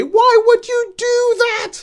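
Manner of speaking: loud high exasperated cry, drawn out; outburst. Acted. Podcaster acting out his friend's reaction to a film, exasperated outburst. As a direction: wail it in disbelief at full volume. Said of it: "at what point does he say".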